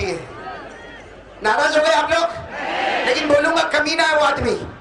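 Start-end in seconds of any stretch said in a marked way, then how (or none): 1.4-4.7 high, ringing call to the crowd; full-force preaching. Acted. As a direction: rouse the congregation in a raised voice. (जोशीली तक़रीर, ऊँची आवाज़ में)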